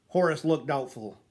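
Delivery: ordinary delivery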